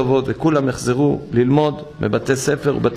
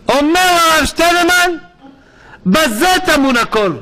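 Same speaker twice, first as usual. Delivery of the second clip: much higher and louder, ringing out; fervent proclamation; 11 semitones higher, 4 dB louder than usual. Real, not acted. Preacher quoting Rabbi Steinman to his listeners, loud exclaiming. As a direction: declare it like a call from the pulpit.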